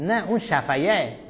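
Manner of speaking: ordinary talk, nothing marked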